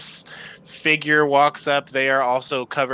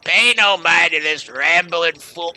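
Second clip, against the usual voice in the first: louder, gruff dwarf brushing her off; dismissive dwarf; 6 semitones higher, 7 dB louder than usual. Acted. Dwarf captain to the crew about the half-elf, scornful. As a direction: wave her away, then snap shut up. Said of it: "And he says back".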